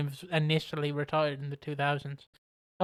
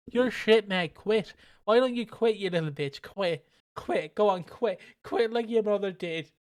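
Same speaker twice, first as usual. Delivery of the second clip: higher-pitched goading jeer; mock taunting; 4 semitones higher than usual. Elijah Burke goading a beaten partner to quit, mock taunting. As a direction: badger him in a mock heckle. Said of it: "Elijah Burke basically goes up and goes".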